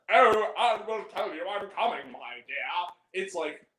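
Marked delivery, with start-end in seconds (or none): 0.1-2.6 put-on overdone English accent; caricatured English accent, dropping away at the end; Freddie Highmore. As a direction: ham up a plummy English accent. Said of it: "it's really overly done".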